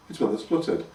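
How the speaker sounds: plain reading voice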